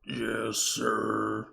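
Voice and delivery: slow-witted servant drawing out the words; dutiful, drawn-out reply; obedient henchman. Acted. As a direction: answer as a lumbering henchman.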